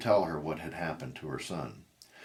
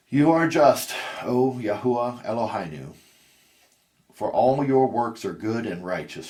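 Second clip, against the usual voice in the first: lifted, much louder and higher cry to God; loud praise; 5 semitones higher, 8 dB louder than usual. A narrator's reading. Grieving mother Sarah crying out her praise to God, loud and high. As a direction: cry it up to the heavens.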